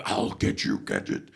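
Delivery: deep, whispered villain threat; low menacing villain hiss. Shown as a demonstration. Comedian demoing his villain voice. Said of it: "I've done villains".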